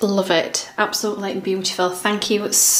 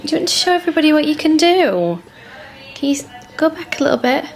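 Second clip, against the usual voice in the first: talking to a pet; 6 semitones higher, faster than usual. Real, not acted. Talking to dog, showing tricks.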